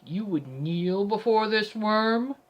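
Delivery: slow, imperious demand; glaring down at a lowly offender; haughty Lightbringer. A narrator's reading. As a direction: draw it out with lordly disdain. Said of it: "He glares at me like the sun from on high".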